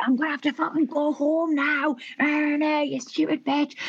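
high-pitched imitation of an angry woman; mock tantrum, dragging out the insult; retold outrage in a high, put-on voice; fuming. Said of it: "She was fuming".

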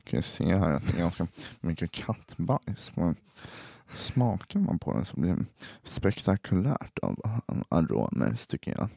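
low, mumbled expert verdict; Leif GW Persson. Acted. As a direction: deliver it like a solemn TV expert. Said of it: "Jag kan imitera Leif G W Persson".

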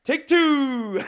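high, loud "Take two" through laughter; laughing take two. Retold or imitated. Groom retelling his own yell, a high, loud "Take two" through laughter. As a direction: crack up while quoting it. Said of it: "But I already done like".

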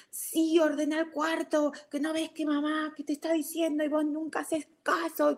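high-pitched mock yelling, no real volume; imitated scolding yell. Mother mimics her son yelling at his sister, high mock scolding. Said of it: "empieza a gritarle a su hermana".

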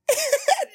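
short high giggle; high laugh. Real, not acted.

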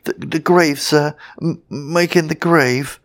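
high, nervous sexton's stammer. A narrator's reading. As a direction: trip over the first sounds in fright. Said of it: "stammered the sexton".

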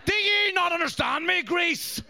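high, forceful mock Northern Irish; Northern Irish accent. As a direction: imitate an exasperated teacher in Northern Irish accent. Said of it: "of that fucking accent".

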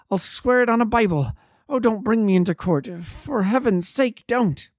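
high, loud, desperate begging; frantic shrieking plea. A narrator's reading. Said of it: "he shrieked".

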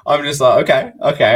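loud, high, breaking into laughter; amused retelling. Real, not acted. Recalling his reply to the heckler, laughing. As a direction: laugh through the retold reaction.

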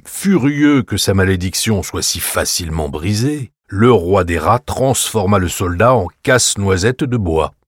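ordinary delivery

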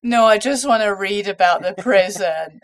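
loud begging to just read about it; pleading protest. Retold or imitated. Refusing the shouting prison actor.

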